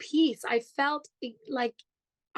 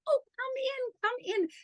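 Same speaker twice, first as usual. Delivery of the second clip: much higher, eager come-in voice; host pulling the guest in, much higher pitch; imitating Suchitra's warm invite; 12 semitones higher, faster than usual. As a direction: coax a stranger inside, sing-song. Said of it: "And she goes".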